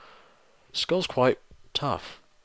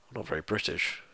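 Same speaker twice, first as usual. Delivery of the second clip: quieter, mock dejected admission; 11 dB quieter than usual. Real, not acted. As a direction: drop it like a sad confession.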